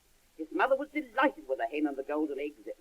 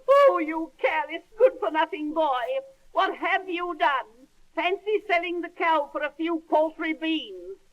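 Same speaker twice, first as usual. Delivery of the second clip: much higher, loud furious mother; angry mother; 9 semitones higher, 3 dB louder than usual. Acted. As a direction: berate the boy in a high angry voice. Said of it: "his mother was very angry".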